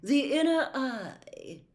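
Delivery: slow, lofty, dignified; with dignity. A narrator's reading. Trelawney correcting Harry, slow and dignified. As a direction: draw yourself up proudly. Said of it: "with dignity".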